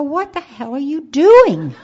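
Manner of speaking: loud, high, slow outraged yell; shocked shout. Retold or imitated. Wife shouting as medics carry her out. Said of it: "And I go".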